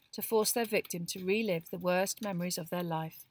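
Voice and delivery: ordinary delivery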